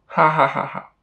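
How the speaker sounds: hearty mocking laugh; mocking laughter. Parents laugh at decision.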